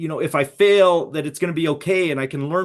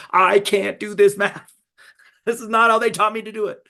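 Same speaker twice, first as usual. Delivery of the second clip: frustrated dad; 6 semitones higher, 3 dB louder than usual. Retold or imitated. Dad gives up on daughter's math, higher, mock despair. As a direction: throw up your hands at the new math.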